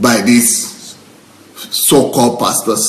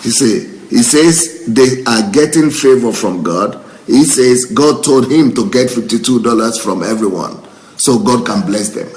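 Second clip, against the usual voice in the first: ordinary talk, nothing marked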